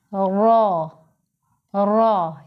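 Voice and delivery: रा with a full, heavy, rounded r. Shown as a demonstration. Teacher shows pupils a heavy, full रा. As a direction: say रा thick and full. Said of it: "ये पुर पढ़ेंगे".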